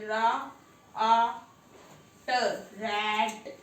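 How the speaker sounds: teacher sounding out each letter slowly; phonics sounding out. Shown as a demonstration. Teacher shows child phonics, sounding out. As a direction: sound out रा, आ, ट then blend into रैट. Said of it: "ऐसे नहीं पढ़ना phonetic से".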